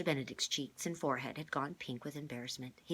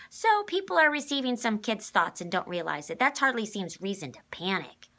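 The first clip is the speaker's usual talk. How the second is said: put-on higher, louder, sour girl; 7 semitones higher, 11 dB louder than usual. A narrator's reading. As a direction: complain like a sulky girl. Constance character voice.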